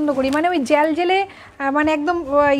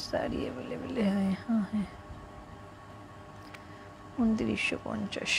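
low whispered aside, much softer; hushed aside; 5 semitones lower, 14 dB quieter than usual. Real, not acted. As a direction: murmur it under your breath, off to the side. Hushed aside during live sale.

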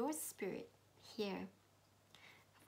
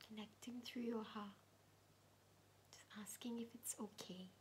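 meditation whisper; 5 dB quieter than usual. Acted. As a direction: whisper gently, guiding inward.

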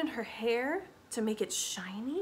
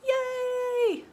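high, loud yay; excited cheer; 5 dB louder than usual. Acted. Voicing the clapping baby, an excited cheer. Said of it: "He's so excited".

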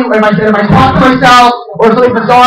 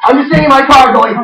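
ordinary talk

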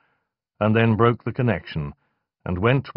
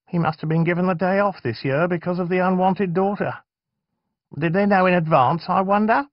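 suspicious; 11 semitones higher, 3 dB louder than usual. Acted. Aaron voiced, suspicious.

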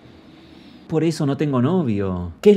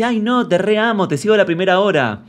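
much higher and a bit louder, fake-sweet gushing; mocking sugary girl; 7 semitones higher, 4 dB louder than usual. Vlogger mocks the girl who claims love, in a high fake-sweet voice. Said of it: "Y ella dice".

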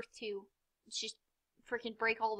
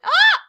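sudden high, loud yelp; startled yelp; 20 dB louder than usual. Real, not acted. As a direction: cry out as if caught off guard. Startled during the game.

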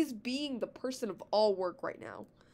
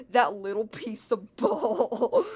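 slow, louder scoff, last word drawn out; 6 dB louder, much slower than usual. A narrator's reading. Luigi at the chain chomp blocking the way, drawn-out scoff. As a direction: scoff it and stretch it out. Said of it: "Luigi scoffed and said".

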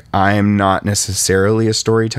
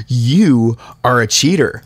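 mock accusing; 5 semitones higher, 3 dB louder than usual. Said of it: "he was like".

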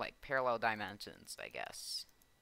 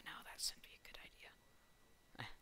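soft breathy whispered reply; 16 dB quieter than usual. Real, not acted. Gamer whispering his answer to himself, hushed whisper. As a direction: answer yourself under your breath.

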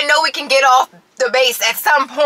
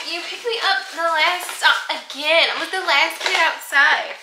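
daughter imitated; 7 semitones higher than usual. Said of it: "she will be like".

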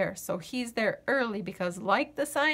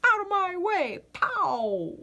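bully eggplant; 6 semitones higher than usual. Retold or imitated. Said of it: "he ran by me and pushed me".

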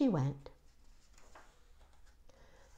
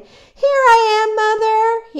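put-on high, loud baby bird call; piping storybook shout to someone far off; 12 semitones higher, 12 dB louder than usual. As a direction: holler up to a faraway mother. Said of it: "he called out".

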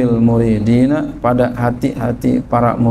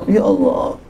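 desperate whispered plea; 7 semitones higher than usual. Retold or imitated. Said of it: "Siang malam dia berdoa".

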